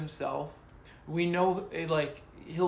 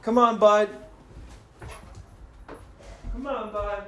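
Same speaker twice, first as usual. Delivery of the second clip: loud call to the dog; 7 dB louder than usual. Real, not acted. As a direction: call him in from across the room. Calling the dog over.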